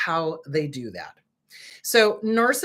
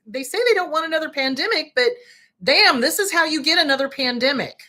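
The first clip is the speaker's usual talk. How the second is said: higher and louder, exasperated disbelief; exasperated outburst; 7 semitones higher, 3 dB louder than usual. Real, not acted. Ranting at viewers about the government, exasperated outburst. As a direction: throw up your hands at the government.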